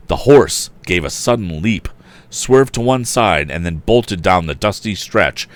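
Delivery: ordinary delivery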